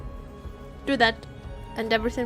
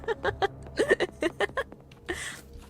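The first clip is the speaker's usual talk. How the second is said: high giggles trailing off; laughing fit; 8 semitones higher, 7 dB quieter than usual. Real, not acted. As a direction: giggle until out of air. Streamer cracks up at game.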